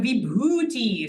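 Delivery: "vibhuti" with a long, stressed "bhu"; stress in middle. Shown as a demonstration. Teacher showing long syllables, middle-stressed "vibhuti". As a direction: slowly draw out "bhu" in "vibhuti". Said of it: "put the emphasis in the middle".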